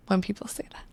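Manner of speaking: really on the edge of crying, barely voiced; choked up. Real, not acted. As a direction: voice failing into a tearful whisper. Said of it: "Sorry, I'm just emotional today".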